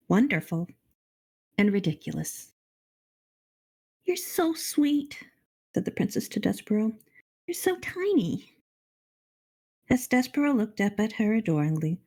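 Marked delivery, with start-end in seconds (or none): 4.0-5.2 high, slowly drawn-out princess voice; cooing princess. A narrator's reading. Princess to the little mouse, cooing. 7.5-8.5 very high princess voice; cooing princess. A narrator's reading. Princess marvels at the tiny mouse, cooing.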